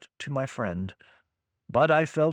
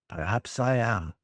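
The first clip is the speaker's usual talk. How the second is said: put-on German accent; 7 semitones lower than usual. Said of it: "bad German accent".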